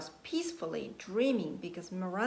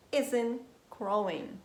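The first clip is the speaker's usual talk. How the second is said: ordinary delivery, nothing marked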